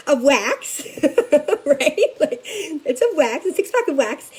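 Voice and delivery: giggling through the words; the teller's puzzled question sets off her laugh. Real, not acted. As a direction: laugh while explaining the joke. Laughing at her own joke.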